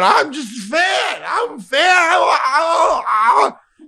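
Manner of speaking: high, loud drunken mock wail; wailing protest. Hammered fan defending himself to the stands, wailing. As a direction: holler it like a drunk pleading his innocence.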